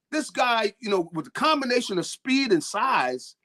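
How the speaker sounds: ordinary delivery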